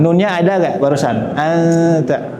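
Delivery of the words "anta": Anta with the n half hidden as a nasal hum; ikhfa, hidden nun. Shown as a demonstration. Teacher showing students ikhfa, Anta with a nasal hum for the nun. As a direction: let the n of Anta fade into a nasal hum before the t. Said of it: "bunyi nunnya nggak jelas".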